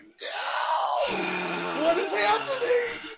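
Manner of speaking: high-pitched wailing shout, half laughing; hammed-up scream of disbelief, drawn out; mock anguished cry. Fan reacting to a show's plot twist, mock anguished cry. Acts own dismayed reaction to show.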